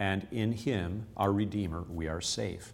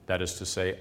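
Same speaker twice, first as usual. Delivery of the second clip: ordinary talk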